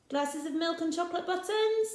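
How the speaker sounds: really, milk and buttons?; incredulous question. Acted. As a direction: Rossetti teasing the children's odd list. Rossetti questions the list.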